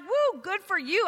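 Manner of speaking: high, bright mock cheer for the family; a sarcastic little whoop. Teen mocking her family's new faith with a high whoop. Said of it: "It was like".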